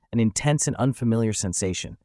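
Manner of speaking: ordinary delivery, nothing marked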